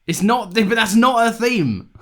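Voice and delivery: high, loud, drawn-out protest; exasperated denial. Real, not acted. Co-host denying it is her theme, loudly exasperated. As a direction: stretch it out in exasperation. Mock quarrel over a theme.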